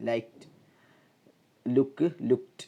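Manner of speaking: "liked" as "likt", "looked" as "lukt"; crisp t closing "liked" and "looked"; T ending. Shown as a demonstration. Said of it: "t എന്ന് വരും". ("liked", "looked" അവസാനം "t" ശബ്ദം)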